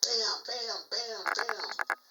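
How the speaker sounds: mimicking heavy knocks; imitated pounding. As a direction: hit each one like a thump on the wall. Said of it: "like, pounding on the wall".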